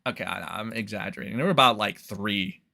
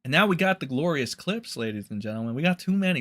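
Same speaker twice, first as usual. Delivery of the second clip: ordinary talk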